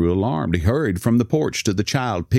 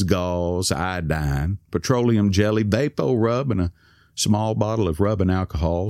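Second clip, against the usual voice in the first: plain reading voice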